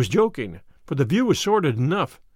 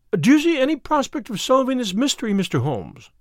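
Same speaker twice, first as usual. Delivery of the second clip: higher-pitched woman's question with a slight edge; touch of asperity; 7 semitones higher than usual. A narrator's reading. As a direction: start high and a little testy, let the name drop. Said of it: "with a touch of asperity in her voice".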